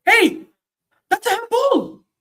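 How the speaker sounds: high, loud appeal to the referee; shouted, high-pitched fan appeal; outraged shout. Real, not acted. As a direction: yell in real protest. Appeals for a handball, live.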